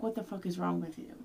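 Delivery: usual speaking voice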